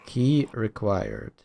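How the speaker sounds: slow, stretched "Key required"; drawn out. Real, not acted. Player repeating the locked-door prompt, slow and drawn out. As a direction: drag out "Key" and "required" slowly.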